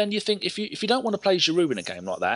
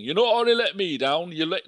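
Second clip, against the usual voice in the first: Brian Clough; 3 dB louder than usual. Retold or imitated. Podcaster quoting a football manager's rebuke, botched Yorkshire accent. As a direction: try a Yorkshire accent that slips towards Scottish. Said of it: "the Brian Clough thing".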